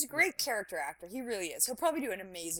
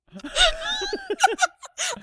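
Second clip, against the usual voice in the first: high shrieking laugh; 11 dB louder than usual. Real, not acted. Laughing after the song.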